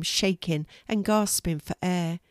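plain reading voice

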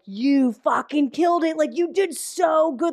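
imitated gushing, slow and drawn-out; gushing praise. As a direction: stretch out the praise like an overawed coworker. Retelling coworkers' gushing drunk-karaoke praise.

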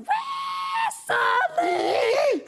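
very high, wild mock howl; screaming. Drunk boyfriend flipping her around in bed, imitated screaming. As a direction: shriek like an over-excited drunk.

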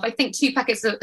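ordinary talk, nothing marked

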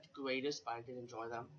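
ordinary talk, nothing marked

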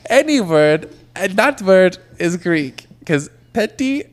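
bad fake Greek accent. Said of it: "your Greek accent is not hitting".

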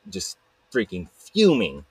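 usual speaking voice

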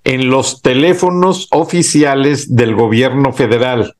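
ordinary delivery

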